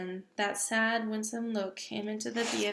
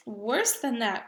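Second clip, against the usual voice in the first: laughing cry; 3 semitones higher, 7 dB louder, slower than usual. Acted. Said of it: "cried the B F G, roaring with laughter".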